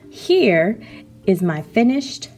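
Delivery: ordinary talk, nothing marked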